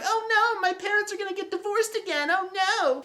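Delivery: very high falsetto mock distress; hammed-up squeaky wail making fun of her; mock panicked girl. Mocking the sister's panic in retelling.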